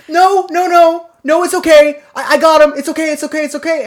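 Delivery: high, rapid repeated reassurances; flustered panic. Retold or imitated. Embarrassed neighbor fending off the boys, high and flustered. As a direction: imitate the neighbor frantically waving help away.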